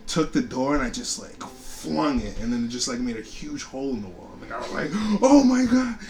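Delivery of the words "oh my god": high, loud "oh my God"; shocked. Retold or imitated. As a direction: gasp it out in alarm. Shocked at hole in wall.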